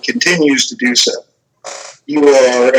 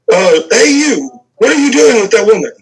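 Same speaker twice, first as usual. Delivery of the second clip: official guard call; 7 semitones higher than usual. Acted. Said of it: "official sounding voice, uh, shout out".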